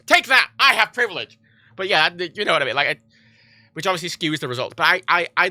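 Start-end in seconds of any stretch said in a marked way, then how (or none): 0.1-1.4 raised, loud mock gloating; mock triumph. Jokingly flaunting privilege, mock triumph. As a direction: gloat jokingly, high and punchy.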